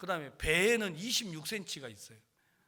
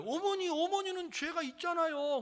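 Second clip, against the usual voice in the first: son's imitated protest; 10 semitones higher than usual. Son telling his mother she has sin, high protest. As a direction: plead the point in a high, put-on voice.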